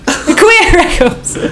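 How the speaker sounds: giggling through the words; laughing. Real, not acted. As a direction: laugh while saying it. Laughing at band name idea.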